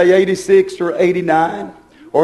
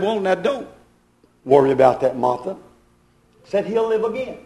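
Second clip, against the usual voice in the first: a hint of Jesus quietly reassuring Martha; gentle comforting Jesus; 2 semitones lower, 5 dB quieter than usual.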